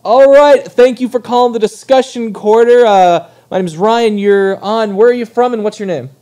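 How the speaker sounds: loud, bright call-in host greeting; radio host voice. Acted. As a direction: welcome the caller like a DJ.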